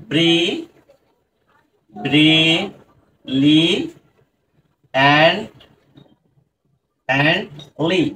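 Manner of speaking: "ব্রি", "লি", "and", "লি" one by one with long pauses; teacher's separated "ব্রি", "লি", "and", each held apart; broken into syllables. Shown as a demonstration. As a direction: sound out "ব্রি… লি… and… লি" piece by piece. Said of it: "আমাদেরকে ভেঙে ভেঙে উচ্চারণ করতে হবে".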